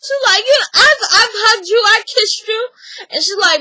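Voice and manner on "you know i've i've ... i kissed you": high, loud imitation of a defensive mom; protesting mother. Mother insisting she showed affection, mock high protest. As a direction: plead it up high, mock-indignant.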